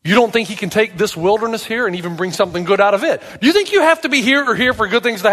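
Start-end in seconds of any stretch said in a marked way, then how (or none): none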